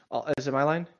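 ordinary talk, nothing marked

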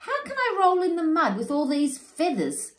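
high, loud fretting; panicked wail. Acted. Warthogs panicking over their new bodies, high wailing. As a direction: lament each new body part.

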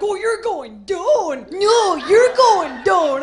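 put-on slow, loud Minnesotan accent, building; bar-fight threat with round Minnesotan O's; hammed-up Minnesotan shout, drawn way out. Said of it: "when they get into a fight".

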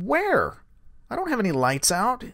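high-pitched incredulous question; retold disbelief. Driver disputing the deputy about his lights, high retold disbelief.

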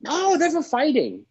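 mock Thai accent, lifting high on Oh. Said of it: "if you're offended by me doing his accent".

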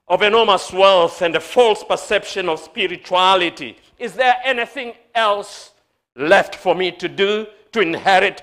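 loud, high, ringing sermon delivery; full-force preaching. Acted.

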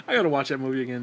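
normal talking voice

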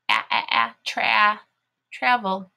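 short "ah" repeated alone, then built into "tra-", "travel"; sounding out. Shown as a demonstration. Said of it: "It makes the ah sound".

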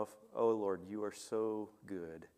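normal talking voice

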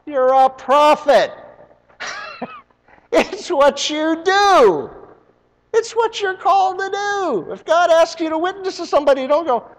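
high, loud preacher's build; full-force pulpit preaching. Acted. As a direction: preach it out, driving the story. Preaching about obedience.

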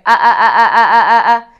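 loud ah pulses squeezed from the throat; throaty ah. Shown as a demonstration. Voice teacher demonstrating the wrong, loud throaty ah. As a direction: show the wrong way, pushing every ah from the throat. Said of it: "coming out from your throat and going".

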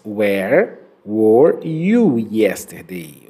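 "Where were you yesterday?" said very slowly, each word drawn out for repeating; slow careful model reading. Shown as a demonstration. Teacher models a tongue twister slowly for students. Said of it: "nesta frase nós temos até um trava-línguas".

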